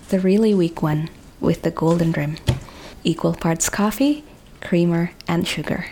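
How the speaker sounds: steady, even storytelling read; audio drama narration. A narrator's reading. Narrator setting up the office coffee scene, calm storytelling. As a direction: narrate the scene in a measured, unhurried way.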